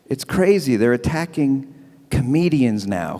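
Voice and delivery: ordinary talk, nothing marked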